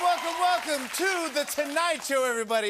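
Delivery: big, high welcome shout over the crowd. Real, not acted. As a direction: call it out to the whole room. Host greeting the cheering studio audience.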